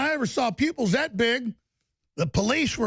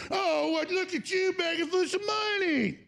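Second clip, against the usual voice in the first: mocking; 7 semitones higher than usual. Mocking a rival's donation plea.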